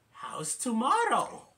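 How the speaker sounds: high, loud, drawn-out suggestion; Claudia. Retold or imitated. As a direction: lift it high and stretch the last word. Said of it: "Claudia says".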